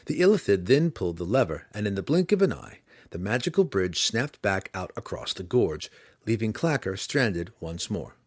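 plain reading voice

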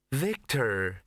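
lightly sweet, eerie calm; a touch of eerie sweetness; sweetly. Acted. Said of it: "she said sweetly".

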